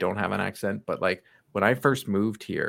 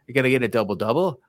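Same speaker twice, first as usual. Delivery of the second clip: louder, quick joke Canadian; Canadian accent; 3 semitones higher, 5 dB louder, faster than usual. Acted. Joke video coffee order, mock Canadian accent. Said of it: "pretty much the typical Canadian accent".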